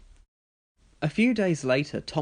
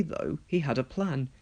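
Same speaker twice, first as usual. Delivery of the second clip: usual speaking voice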